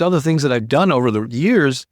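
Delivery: ordinary talk, nothing marked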